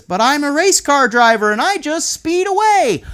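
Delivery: high, loud boasting race car driver; boy refusing bedtime as race driver; excited kid voice. Acted. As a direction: brag like a kid zooming off. Child voiced in picture story.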